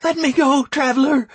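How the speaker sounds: high resigned woman's plea. Acted.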